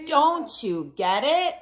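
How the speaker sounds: slow, high exasperated plea; can't believe he doesn't see. A narrator's reading. Frustrated mole rats.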